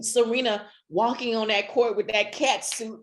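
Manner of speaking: normal talking voice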